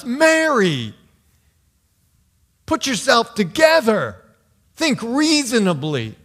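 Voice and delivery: loud, high, exasperated scolding; hammed-up scold, talking sense into Mary; scolding disciple voice. Preacher voicing a disciple scolding Mary, loud and exasperated. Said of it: "I could hear myself saying things like this".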